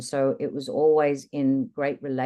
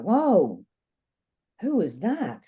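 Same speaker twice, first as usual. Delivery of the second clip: startled whoa; 4 semitones higher, 4 dB louder, much slower than usual. Retold or imitated. Shocked at own calm reaction.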